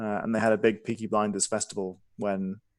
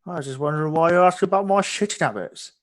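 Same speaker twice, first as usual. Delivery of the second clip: innkeeper voice; 6 semitones higher than usual. Acted. Innkeeper NPC in character.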